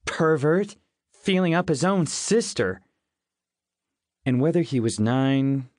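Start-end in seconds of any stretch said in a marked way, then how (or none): 0.1-2.8 hollered. A narrator's reading. Tickled sister denouncing her brother, mildly hollering. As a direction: cry out in raised-pitch accusation. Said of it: "she'd holler".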